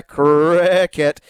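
"cricket" stretched right out with relish; drawn-out "cricket". Real, not acted. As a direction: draw the word "cricket" out very slowly. Playful drawn-out show-title announcement.

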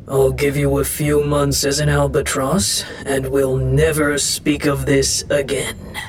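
deep, imperious deity voice; grumpy wind god. Acted. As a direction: pass sentence in a low godly register. God passes sentence on priestess.

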